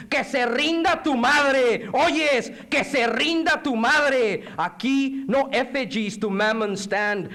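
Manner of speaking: high, ringing poetic defiance; defiant declamation. Acted. Poet voicing those who refuse to give in, high and defiant. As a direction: proclaim refusal like a rallying speech.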